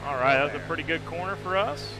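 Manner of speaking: race commentary. Acted. Live race commentary to viewers.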